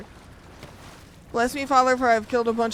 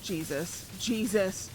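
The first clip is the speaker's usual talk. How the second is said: ordinary talk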